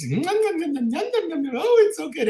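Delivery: high-pitched mock gobbling and raving; hammed-up munching in a high voice; greedy eater impression. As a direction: imitate someone stuffing their face, squealing with delight. Mimicking cousins gobbling the pork.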